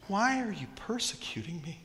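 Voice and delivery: soft whispered gentle question; caring voice. Pretend. Jesus speaking to Saul, gentle caring whisper. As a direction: ask it tenderly, almost a whisper. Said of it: "you get this caring voice".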